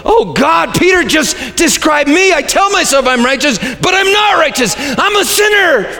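high, rising mock lament ending in a cry; despairing self-talk. Preacher voices a listener's guilty realization, mock despair. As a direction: wail it like a guilty soul. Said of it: "if you're thinking to yourself".

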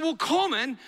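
put-on high, proud little-girl reply; proud older sister. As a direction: imitate a proud big sister. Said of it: "rather proudly".

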